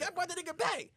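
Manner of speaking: high-pitched self-defence; mock defensive protest. [name] tells the bride he brought him back, high protest. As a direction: insist you helped, voice way up.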